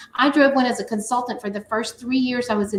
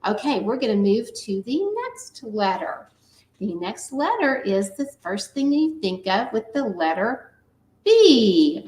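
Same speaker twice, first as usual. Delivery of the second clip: usual speaking voice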